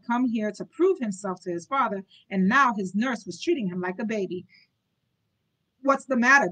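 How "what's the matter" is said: louder, higher, mocking the boy; taunting. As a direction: jeer at him. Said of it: "the Duke taunted".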